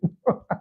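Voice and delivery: voiceless wheezing laugh; real laughter. Real, not acted. Cracking up after the quote.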